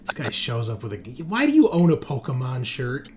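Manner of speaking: ordinary talk, nothing marked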